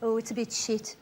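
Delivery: low, soft, unimpressed mumble; glum deadpan. Retold or imitated. Birmingham local unimpressed by his own city, low and soft. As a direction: shrug it off like a bored local. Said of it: "they all go".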